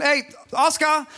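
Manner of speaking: calling out. Retold or imitated. Father calls for the carpenter Oscar, calling out. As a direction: call a name through the house, stretched out. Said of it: "hab ich gerufen".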